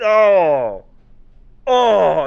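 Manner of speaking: loud, high groan; real high groan of despairing delight; exasperated groan. Real, not acted. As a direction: groan loudly, utterly overwhelmed. Overwhelmed groaning about the absurd game.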